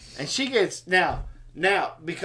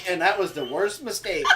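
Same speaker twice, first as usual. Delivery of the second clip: ordinary talk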